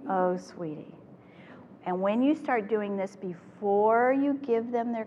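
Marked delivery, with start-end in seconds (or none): 0.1-0.9 "Oh, sweetie" soft, falling, gently sorry; empathetic "oh sweetie". Shown as a demonstration. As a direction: let it fall with quiet sadness. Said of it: "But I'm not saying any of those words".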